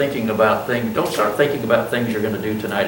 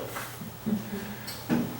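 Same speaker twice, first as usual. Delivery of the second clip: laughing; 9 semitones higher, 12 dB quieter than usual. Real, not acted. Laughs at himself.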